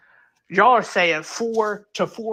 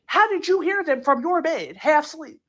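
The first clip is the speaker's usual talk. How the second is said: usual speaking voice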